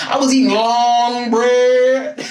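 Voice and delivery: slow, loud hammed-up French accent; French woman. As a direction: ham up a French lady. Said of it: "a whole French woman".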